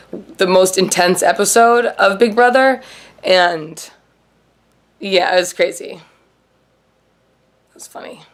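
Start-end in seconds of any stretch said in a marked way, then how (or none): 5.9-8.2 slow breathy whisper aside; slow secretive whisper. Real, not acted. Aside after intense episode.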